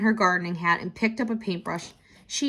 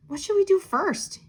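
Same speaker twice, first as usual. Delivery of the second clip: put-on much higher, curious child question; little girl asking; 12 semitones higher than usual. A narrator's reading. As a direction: ask it as an eager little girl. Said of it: "she asked".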